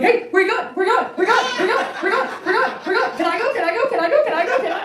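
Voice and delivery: very high, loud, eager dog voice pestering; be a needy dog begging to come along; excited dog. Retold or imitated. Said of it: "We're like".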